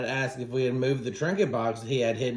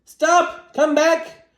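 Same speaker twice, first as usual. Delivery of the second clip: high, loud urgent yell; 14 semitones higher, 8 dB louder, much slower than usual. A narrator's reading. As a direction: call after someone running away. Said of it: "so I yelled".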